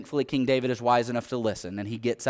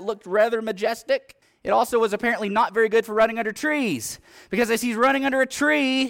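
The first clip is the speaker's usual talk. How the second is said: high, loud, mounting preacher's drive; climbing toward the climax of the battle; preaching at full force; 9 semitones higher, 5 dB louder than usual. Acted. Preacher telling the congregation of Absalom's death, building, loud.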